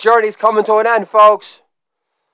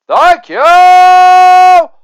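higher and louder thanks, falling on you; 8 semitones higher, 6 dB louder than usual. Real, not acted. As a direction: thank you belted out high. Thanking crowd loudly.

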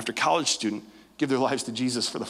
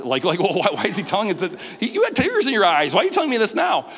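high, laughing disbelief, louder; retold incredulous outburst; 6 semitones higher, 4 dB louder than usual. Telling the congregation about Ren's confession, incredulous laughing high voice. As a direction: laugh through the confusion, voice climbing.

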